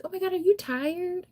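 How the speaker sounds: high, put-on voice of nosy people; mock concerned people. Mocking strangers who ask if she is tired, high put-on voice. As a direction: squeak it like a fussy stranger. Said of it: "people aren't like".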